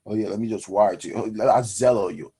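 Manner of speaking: low, fast, breezy offhand voice; mock the casual lender rushing it; careless lender. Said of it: "Don't be just cash apping people".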